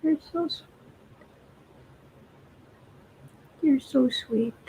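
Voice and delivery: soft, tender coo to a pet. Real, not acted. Owner cooing at her cat.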